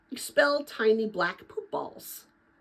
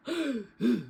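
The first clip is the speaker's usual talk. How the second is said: gasp; 2 semitones higher, 3 dB quieter than usual. Acted. After the whispered vow.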